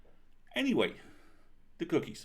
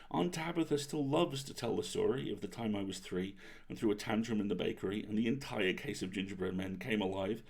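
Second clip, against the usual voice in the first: plain reading voice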